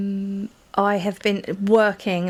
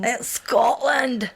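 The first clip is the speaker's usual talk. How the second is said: drawn-out, exaggerated Scottish accent, pitched up; teasing, stretched Scottish accent, higher; mock Scottish accent; 4 semitones higher, much slower than usual. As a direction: send up the Scottish accent, dragging it out. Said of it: "Sorry, Scottish people".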